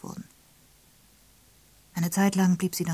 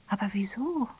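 higher, rising question of disbelief; aghast; 5 semitones higher than usual. A narrator's reading. Molly questions Evelyn about her marriage, aghast. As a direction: ask it aghast, pitch lifting at the end. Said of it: "sagte Molly entgeistert".

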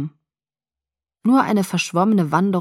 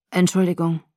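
grumbled. A narrator's reading. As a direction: mumble the apology gruffly, lightly voiced. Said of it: "brummte Billy".

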